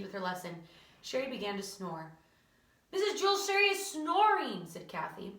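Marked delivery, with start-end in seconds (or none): 2.9-4.7 high, loud schoolkid complaint; urgent tattling. Acted. Pupil alerts teacher to a snoring classmate, loud tattling. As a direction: tell on a classmate loudly, pitched up.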